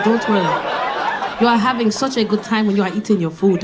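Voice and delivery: put-on pleased, laughing African accent; African date's compliments. Date praising her eating, in a laughing African accent. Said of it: "He was like".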